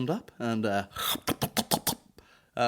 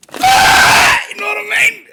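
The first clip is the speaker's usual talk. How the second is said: high screaming mock climax; mock orgasm shout; 15 dB louder than usual. Parodying the man's climax, screaming high.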